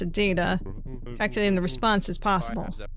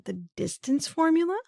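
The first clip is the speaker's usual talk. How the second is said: high rising disbelief; pitched-up questioning echo, baffled by the hint; incredulous echo; 8 semitones higher than usual. Real, not acted. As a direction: repeat it back in disbelief, pitched up.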